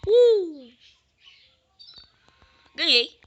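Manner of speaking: loud high triumphant yell; victory shout. Real, not acted. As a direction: shout it, you won.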